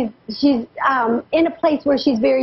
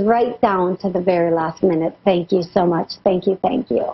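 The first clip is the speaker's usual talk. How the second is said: grateful thanks; 6 semitones lower than usual. Retold or imitated. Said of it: "She says".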